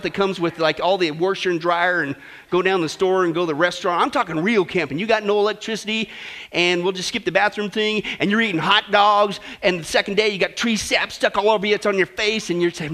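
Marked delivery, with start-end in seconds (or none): none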